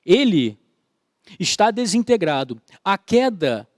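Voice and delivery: ordinary delivery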